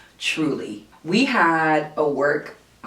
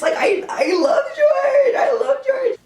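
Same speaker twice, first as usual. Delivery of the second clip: squealed way higher and louder through laughter; laughing panic; 3 dB louder, slower than usual. Retold or imitated. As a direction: shriek it out laughing, half freaking out. Panicking as George walks in.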